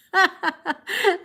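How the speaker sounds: high, loud burst of laughter. Real, not acted. Streamer amused by a viewer's made-up story, laughing high.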